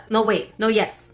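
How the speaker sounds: ordinary talk, nothing marked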